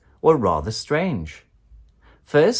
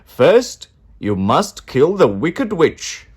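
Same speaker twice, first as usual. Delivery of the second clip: loud, slow commanding wizard voice; booming wizard; 6 semitones higher, 8 dB louder, slower than usual. A narrator's reading. Wizard commands the friends, loud and slow. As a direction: lay down the task like a mighty wizard. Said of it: "said the wizard".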